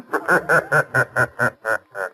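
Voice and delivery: short scornful laugh; scoffing chuckle at the threat; mocking laugh. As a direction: laugh it off with contempt. Boss scoffs at the Shadow.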